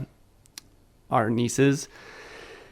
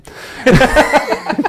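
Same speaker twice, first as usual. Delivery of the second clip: high laugh; 14 semitones higher, 5 dB louder than usual. Real, not acted. Laughing at story.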